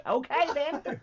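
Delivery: high, loud New Zealand accent. Shown as a demonstration. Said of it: "getting my voice right".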